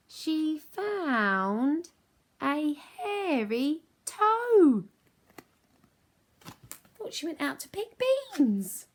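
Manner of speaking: high, loud, slow spooky reading; spooky read-along. Acted. Teacher reading with kids, high spooky emphasis. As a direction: ham up the creepy find.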